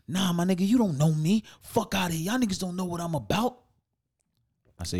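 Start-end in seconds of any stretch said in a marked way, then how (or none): 0.1-3.6 high, loud defiant retort. Retold or imitated. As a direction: puff up and push back.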